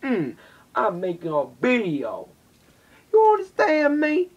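sassy answer ending in a shrill high squeak; cheeky reply. Pretend. Answering the teacher in class, sassy with a squeaky end. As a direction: talk back with mock indignation. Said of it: "I'm gonna be like".

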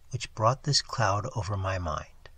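ordinary delivery, nothing marked

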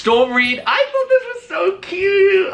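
high squealing gush, slow; excited squeal. Real, not acted. Reviewing a red carpet look, high excited squeal.

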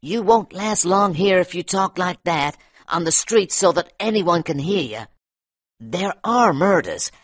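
a lightly voiced miner, anxious and insistent; nervous miner. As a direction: press the danger on the newcomer. Miner warns of McGinty.

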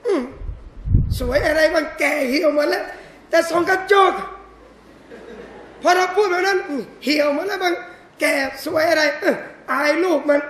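bashful denial, secretly pleased; coy wife. Acted. Wife complimented by husband, coyly brushing it off.

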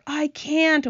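high, helpless wail. A narrator's reading. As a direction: wail it as the glowworm. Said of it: "wailed the glowworm".

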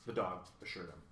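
ordinary delivery

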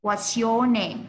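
What's your name said joined, falling, stress on your; model for repeating. Shown as a demonstration. Teacher leading repeat-after-me drill, clear model. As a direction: say it for kids to copy. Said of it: "พูดตาม teacher นะคะ".

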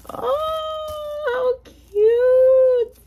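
very high coo swelling loud; long squealed coo, building in volume; delighted squeal. Real, not acted. Crafter seeing the cute paper, swelling squeal.

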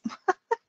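brief self-conscious giggle; quick high laugh. Real, not acted. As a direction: giggle at the slip. Laughs after tripping on a word.

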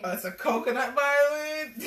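plain reading voice

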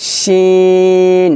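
শিন with tongue middle to palate, air rushing out; long hushing "sh" and stretched vowel in শিন; model shin. Shown as a demonstration. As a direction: draw out শিন very slowly. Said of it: "এভাবে".

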